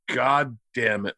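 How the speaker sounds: low, slow, quiet cursing; dad's voice. Retold or imitated. Dad over an open folder, imitated low, slow dismay. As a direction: imitate a dad grimly cursing under his breath. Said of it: "and he goes".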